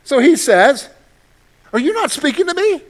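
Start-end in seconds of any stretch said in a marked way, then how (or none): 1.7-2.8 higher, indignant Pilate; a governor offended by silence; upset Pilate. Acted. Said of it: "Pilate gets a little upset".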